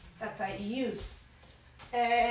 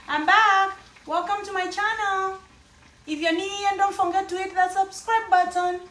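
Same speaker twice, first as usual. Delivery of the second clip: higher and louder, a burst of fresh energy; bright excited re-welcome; 6 semitones higher, 7 dB louder than usual. Real, not acted. Vlogger greets her viewers again after a break, excited and upbeat. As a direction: welcome them back like you're thrilled to return.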